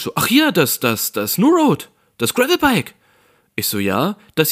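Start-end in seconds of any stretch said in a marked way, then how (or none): 0.0-2.9 much higher and louder, a clerk suddenly excited; half euphoric. Retold or imitated. Clerk on the phone, suddenly half euphoric. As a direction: pop up with sudden delight at the screen. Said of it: "so halb euphorisch".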